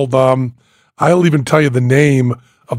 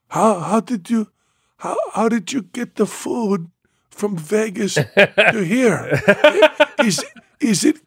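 baffled Jandro; 6 semitones higher, 3 dB quieter than usual. Retold or imitated. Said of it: "He comes back and goes".

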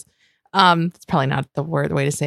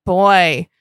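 ordinary talk

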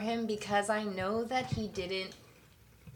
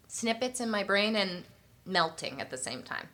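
usual speaking voice

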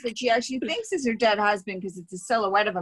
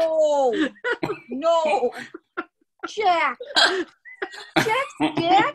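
hammed-up grieving widow; 8 semitones higher, much slower than usual. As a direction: overact the widow's cry for her dead husband. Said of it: "she does this instead".

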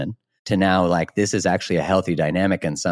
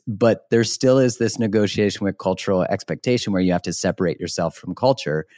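ordinary talk, nothing marked

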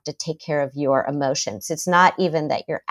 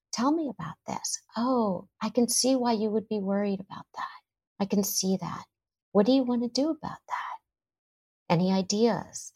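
very soft, understanding parent; hushed, gentle listening to a worried child; calm, grounded; 3 semitones higher, 9 dB quieter than usual. Acted. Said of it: "someone who can calmly, in a grounded way".

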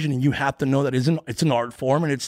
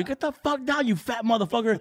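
high mock heckler jeer; heckler yelling; 8 semitones higher than usual. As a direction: yell it like a rude heckler.

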